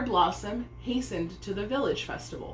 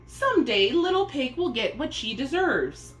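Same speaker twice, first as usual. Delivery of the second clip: higher, louder threat; threatening; 6 semitones higher, 4 dB louder than usual. A narrator's reading. Omani threatening Pear Blossom, raised menacing voice. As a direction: spit it out as a vow of revenge. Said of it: "Omani threatened".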